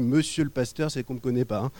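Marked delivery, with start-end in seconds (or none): none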